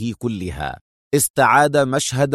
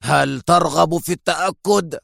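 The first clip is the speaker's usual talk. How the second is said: a touch higher, a veiled threat; lightly menacing dare; angry; 4 semitones higher than usual. A narrator's reading. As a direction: challenge him with mild anger. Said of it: "وهو يقول في غضب".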